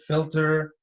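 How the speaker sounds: normal talking voice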